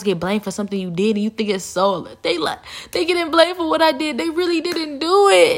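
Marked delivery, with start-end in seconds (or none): 2.9-5.6 high, loud mocking imitation; gloating liar. Liar delighted someone else is blamed, gloating. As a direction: crow it in a high put-on voice.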